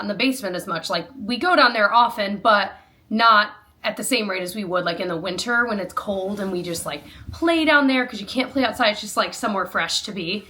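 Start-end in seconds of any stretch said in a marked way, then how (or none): none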